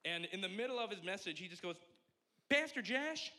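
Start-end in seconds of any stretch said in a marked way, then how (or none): 2.5-3.3 put-on high, loud accented call of an old preacher; piercing, accented preacher impression; Pastor Charlie's accent. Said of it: "I can't do the accent the whole time".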